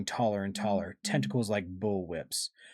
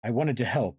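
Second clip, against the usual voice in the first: ordinary delivery, nothing marked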